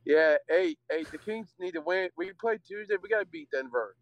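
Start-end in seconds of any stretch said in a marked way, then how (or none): none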